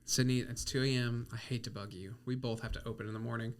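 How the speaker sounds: husband waking wife at 2am; whispered panic. Retold or imitated. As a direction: whisper so nobody wakes.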